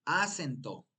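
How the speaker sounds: "Acento" with the first syllable punched: A-cento; stress on first syllable. Shown as a demonstration. Teacher testing where the tonic syllable falls, "Acento" stressed on A. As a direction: hit the opening A of "Acento". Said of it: "Para identificar la sílaba tónica".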